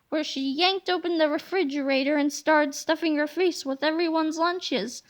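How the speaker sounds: usual speaking voice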